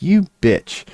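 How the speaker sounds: high put-on voice, mock insult; a playful, squeaky "you bitch" aimed at fate; high mock voice cursing the cat; mock curse.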